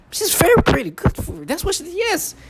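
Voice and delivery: very high, thin mimicking voice; mocking falsetto. Mimicking his tormentors in a squeaky high voice. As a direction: ape their words in a squeaky voice.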